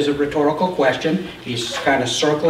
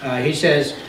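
ordinary talk, nothing marked

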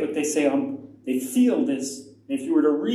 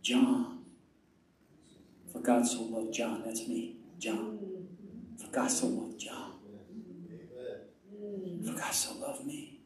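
low, hushed murmur to oneself; soft and intimate, with long pauses; personal meditation; 3 semitones lower, 11 dB quieter than usual. Shown as a demonstration. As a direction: quietly take it in as your own. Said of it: "you can say it like this".

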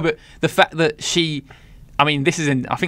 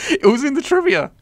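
giggling high through the words; laughing. Real, not acted. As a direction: say it while cracking up. Still laughing after the fact.

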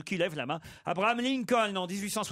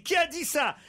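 teacher yelling; 10 semitones higher than usual. Acted. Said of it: "L'institutrice hurle".

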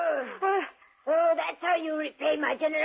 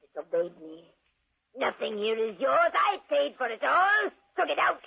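usual speaking voice

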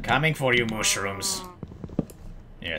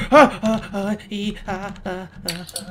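high, fading ah cries; frantic little yelps; panicked yelps; 8 semitones higher, 5 dB louder, slower than usual. Real, not acted. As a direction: yelp in alarm, trailing off. Panicked yelps chasing cow.

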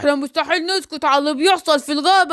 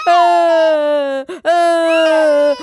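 put-on slow, louder long baby cry; baby wail; 3 dB louder, much slower than usual. Baby Simba wails while his mother changes him, louder. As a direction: wail like an upset infant.

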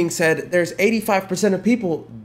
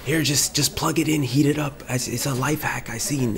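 normal talking voice

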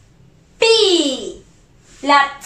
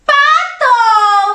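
"Pato" as one stretched word, higher; syllables joined; 6 semitones higher, 4 dB louder than usual. Shown as a demonstration. Teacher revealing the duck word to children, syllables joined. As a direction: blend "Pato" together as a triumphant reveal. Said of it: "Junto, ¿qué dice?".

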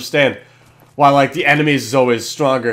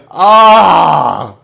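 loud wail of frustration; anguished cry; 7 dB louder than usual. Real, not acted. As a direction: let out a pained yell. Kill streak lost.

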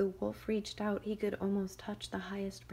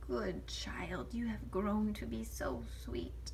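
sly wolf as granny; 3 semitones higher, slower than usual. Acted. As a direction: lure the girl in gently. Wolf disguised as grandmother.

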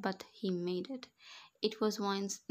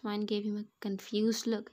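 usual speaking voice

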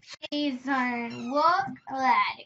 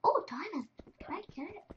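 whisper; 3 semitones higher, 5 dB quieter, faster than usual. Real, not acted. Whispering at the diamond.

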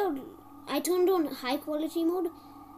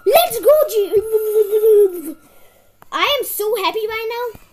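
much louder, slowed, laughing cheer; joyful cheer; 5 semitones higher, 12 dB louder, slower than usual. Real, not acted. Player who just won, cheering and laughing. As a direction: whoop with joy. Winning the game.

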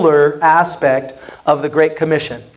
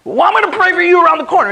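much higher, fast, playfully defiant; cheeky reply; 11 semitones higher, much faster than usual. Retold or imitated. Pastor answers the patient refusing prayer, cheeky and fast. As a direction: toss it off with a cheeky grin. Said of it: "And I'd be like".

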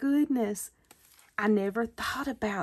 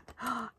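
quick soft surprised gasp; 8 dB quieter than usual. Real, not acted. Crafter spotting the orange light, soft surprised gasp. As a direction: catch your breath in delight.

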